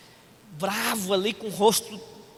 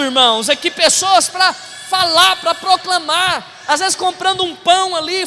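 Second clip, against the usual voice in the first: full-force preaching; 5 semitones higher, 6 dB louder than usual. Real, not acted. Pastor calling the church to proclaim, full-force preaching. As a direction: proclaim it loudly to the whole church.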